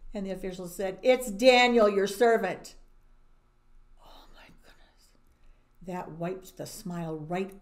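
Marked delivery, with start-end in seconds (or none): 1.0-2.8 loud, pleased tattle on "It's Daniel"; loud tattling official. Acted. 4.0-5.2 breathless whispered gasp; shocked whisper. Real, not acted. Storyteller gasps at the news.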